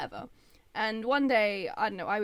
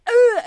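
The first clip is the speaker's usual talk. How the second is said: loud high yelp of pain; pained shout; 13 semitones higher, 17 dB louder than usual. Retold or imitated. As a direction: yelp at the burn. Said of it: "and I was like".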